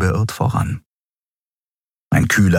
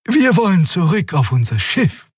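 amiable; 12 semitones higher than usual. A narrator's reading. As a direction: answer kindly and high. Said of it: "Xea explains".

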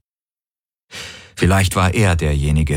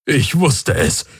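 blurted out; 6 semitones higher, slower than usual. A narrator's reading. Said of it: "stieß Hox aus".

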